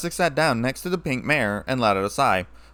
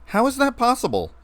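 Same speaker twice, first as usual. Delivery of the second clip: shocked disbelief; 11 semitones higher than usual. A narrator's reading. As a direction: pitch jumps high in gasping surprise. Gasps at eye transplant.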